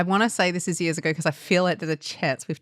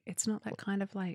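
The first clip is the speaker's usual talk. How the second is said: much softer, murmured mock quote; hushed repeat; 13 dB quieter than usual. Cohost quietly echoing the realtor's absurd line, much softer. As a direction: mutter the absurd line back. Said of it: "repeating realtor's line".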